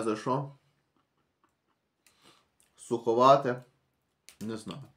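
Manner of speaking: eating a dry cookie; chewing while talking. Real, not acted. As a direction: mouth partly full of cookie. Seen 2: Pops cookie piece in mouth at 0.5, chews while talking.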